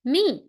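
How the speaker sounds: "Mi" falling sharply; fourth tone. Shown as a demonstration. Teacher demonstrating the falling tone on mi. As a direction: drop "Mi" fast from high. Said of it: "Last tone".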